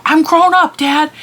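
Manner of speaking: put-on high, loud huffy teen protest; whiny teen Max. Max telling his dad Goofy he's grown, high huffy teen voice. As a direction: pitch it up like a sulky teen insisting he's grown. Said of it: "Max is all".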